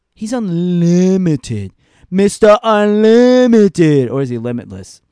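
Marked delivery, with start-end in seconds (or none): 0.1-4.1 slow, much louder ring-announcer call; hammed-up announcer voice; announcer hype. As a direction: boom it out like an arena announcer. Hyping wrestler's nickname.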